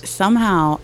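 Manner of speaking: usual speaking voice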